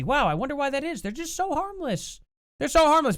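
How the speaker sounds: high mocking sing-song of they're so harmless; sarcastic. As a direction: sneer it, pretending they're totally harmless. Sarcastic remark about NSAIDs.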